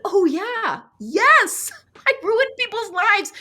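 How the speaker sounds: high bright laughing admission; laughing confession. Retold or imitated. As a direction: gleefully own up. Cheerful confession of own bad deed.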